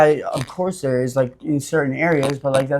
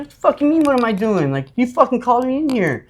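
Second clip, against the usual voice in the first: retold angry yell; 10 semitones higher than usual. Answering the guy he hit, shouting back indignantly. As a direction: throw it back at him hard. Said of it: "I was like".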